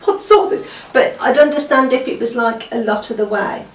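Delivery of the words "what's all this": high, loud incredulous what with a laugh; shocked disbelief. Retold or imitated. Reader baffled by dialect spelling, laughing high disbelief. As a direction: squeak out the shock at the strange spelling. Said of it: "And I thought".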